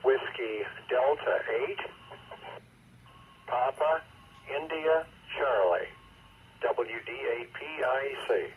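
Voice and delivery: radio room static. Real, not acted. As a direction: crackly voice through a radio repeater. Check-in over a radio repeater.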